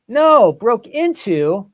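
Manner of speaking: high, loud, drawn-out disbelief; real dismayed surprise. Real, not acted. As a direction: cry out at the wrong answer, slowly. Quiz answer turns out wrong.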